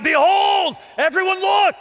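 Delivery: high, full-voiced proclaiming shout. John the Baptist announces Jesus to everyone, shouting. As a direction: shout for everyone to turn and look. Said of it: "This, this shout".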